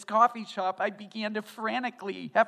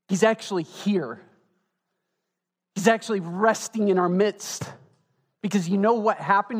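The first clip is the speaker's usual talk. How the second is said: louder, driving sermon voice; full-force preaching; 8 dB louder than usual. Real, not acted. Pastor telling the congregation God is here, full-force preaching. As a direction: preach it out to the room.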